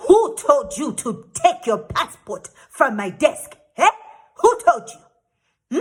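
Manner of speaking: slow, loud, drawn-out challenge; angry officer. Retold or imitated. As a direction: stretch each word like an angry official.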